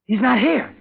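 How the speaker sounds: loud, high panicked orders; panic, hurry her along; sudden panic. Acted. Discovering the empty wheelchair.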